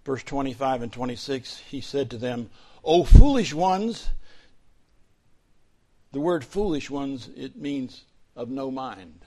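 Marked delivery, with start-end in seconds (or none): 2.8-4.1 louder, slow, drawn-out reproach; solemn rebuke. Acted. Jesus rebuking the two disciples on the road, slow and loud. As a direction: declaim it slowly, full of weight.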